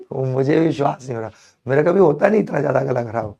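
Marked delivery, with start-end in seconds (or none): none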